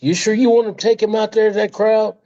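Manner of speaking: high and quick, fretting; mimicking a nervous Pat. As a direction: rush out the worry before the match. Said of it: "Pat was going like".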